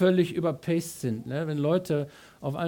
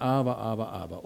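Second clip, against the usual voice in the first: mock excuses; 6 semitones lower, 3 dB quieter than usual. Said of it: "sagen wir".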